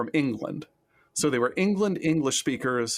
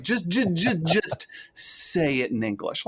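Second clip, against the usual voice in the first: stammered, higher-pitched mock exasperation; exasperated plea; 5 semitones higher than usual. Fast food worker to halting Romanian learner, mock exasperated. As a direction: drag out a pleading imitation of a fed-up worker. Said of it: "And they're like".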